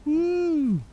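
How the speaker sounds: high showing-off whoop; excited whoop. Real, not acted. Showing off his legs.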